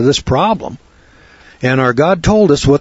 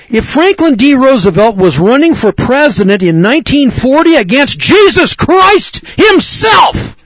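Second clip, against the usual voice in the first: vehement preaching; 10 semitones higher, 4 dB louder than usual. Real, not acted. Preacher railing at Roosevelt's popularity, vehement and climactic. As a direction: hammer it harder up to the last word.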